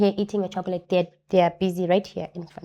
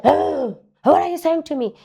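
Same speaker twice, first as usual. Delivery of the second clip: higher and louder, a startled angry retort; retold upset shout; 7 semitones higher, 6 dB louder than usual. Gardener startled by the child, retold as a raised shout. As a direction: imitate the gardener snapping back, alarmed. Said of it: "Why did that man shout at me".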